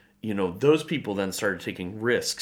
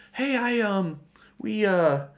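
high, halting retold admission; hesitant confession; 8 semitones higher than usual. Couple telling their pastor about giving, hesitant confession. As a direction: stumble shyly into it, pitched up.

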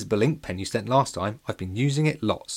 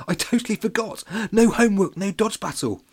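panicked; 10 semitones higher, 4 dB louder than usual. A narrator's reading. Boy panics over forgotten homework.

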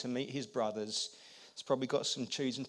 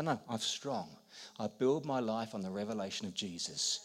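ordinary talk, nothing marked

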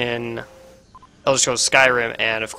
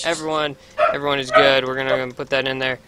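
ordinary delivery

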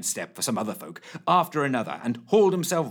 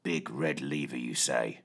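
deep, soft growl; 11 semitones lower, 7 dB quieter than usual. A narrator's reading. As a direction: growl it low and doubtful. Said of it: "he growled".

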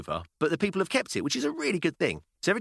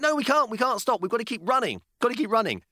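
put-on urgent child; 10 semitones higher than usual. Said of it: "She went".

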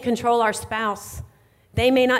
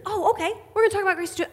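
high breezy confidence; 9 semitones higher, much faster than usual. Retold or imitated. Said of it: "retold chat with husband".

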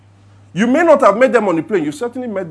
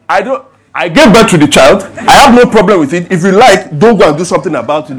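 loud, defiant careless parent; 11 dB louder than usual. Retold or imitated. Mocking a careless parent on a plane, loud and defiant. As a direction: shrug it off loudly. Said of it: "I don't care parent just".

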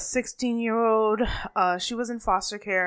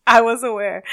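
laughing; 4 semitones higher, 12 dB louder than usual. Real, not acted. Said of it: "My whole Caribbean accent came out".

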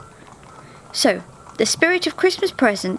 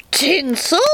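spat; 6 semitones higher than usual. A narrator's reading. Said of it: "she spat".

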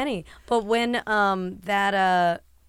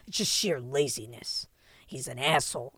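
hushed, lower aside; confiding whisper; 8 semitones lower, 9 dB quieter than usual. Retold or imitated. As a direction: whisper it like a secret insult. Plumber's confiding insult retold.

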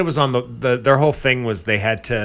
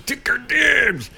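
mock angry yell; 6 dB louder, much slower than usual. Continuing the mock rant.

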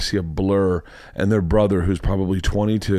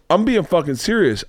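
raised pitch, insistent; retold indignant warning; 6 semitones higher than usual. Storyteller shot twice by kids, retold indignant warning. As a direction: act out demanding to be taken seriously.

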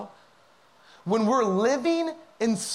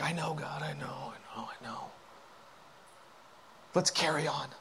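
low, dwindling mumble into a whisper; resigned, very soft acknowledgement; muttered brush-off; 7 semitones lower, 12 dB quieter than usual. Acted. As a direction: brush God off under your breath, fading away. Acted brush-off of God.

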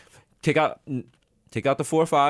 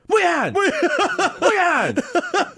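hammed-up parent calling; much slower than usual. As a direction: yell the name across the house. Parent calling child to punishment.

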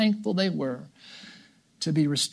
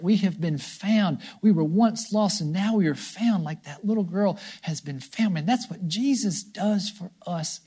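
ordinary delivery

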